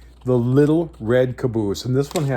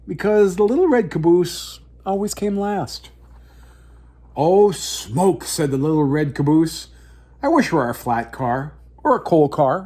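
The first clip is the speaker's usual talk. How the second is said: story narration; 4 semitones higher than usual. A narrator's reading. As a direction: tell it like a bedtime picture book. Reads picture book aloud.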